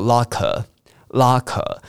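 locker said slowly, crisp k, no curled r; British locker. Shown as a demonstration. Teacher contrasting British with American locker. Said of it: "英国人比较不喜欢卷舌".

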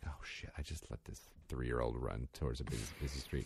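horrified realisation said to herself; low, soft dread; panicked mutter. Retold or imitated. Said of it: "And I'm like".

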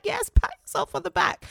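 mock proud inner voice; self-congratulating voice. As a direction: cheer yourself on in a silly voice. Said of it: "I was like".

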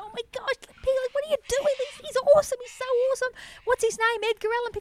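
very high-pitched delighted squealing voice; gushing onlookers. Retold or imitated. Passers-by meeting the pig in the park, gushing. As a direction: imitate a crowd fawning over a pet.

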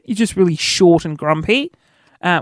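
usual speaking voice

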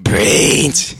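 whispered zombie moan, rising; breathy undead groan on brains. Pretend. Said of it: "So I'm like".

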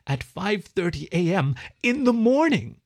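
outraged, pitch soaring at the end; rising indignant squeal. A narrator's reading. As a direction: climb slowly into a high indignant squeal. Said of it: "The last rose to an indignant squeal".

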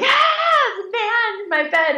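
high-pitched retold scream of terror; bloody-murder scream. Wife woken by husband crawling into bed, bloody-murder scream. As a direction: shriek it as if finding an intruder. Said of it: "I will scream like, like bloody murder".

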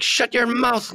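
high-pitched French Canadian heel voice; French Canadian heel accent. Retold or imitated. Imitating a heel wrestler as an example, French Canadian accent. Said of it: "That accent is perfect for a heel".

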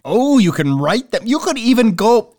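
rising, gushing sponsor hype; mock excited. Host hyping a sponsor's contact details, mock excitement. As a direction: oversell it as if amazed. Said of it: "Could you sound excited about them?".

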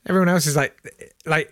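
ordinary delivery

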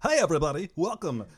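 radio DJ voice. Retold or imitated. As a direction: hype the caller giveaway like AM radio. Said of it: "I do really kind of take on, like, a".

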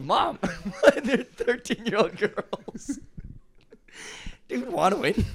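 mock protest. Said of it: "I'm like".

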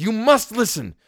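high, forceful command; shouted. A narrator's reading. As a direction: forbid it at a shout. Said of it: "I shouted".

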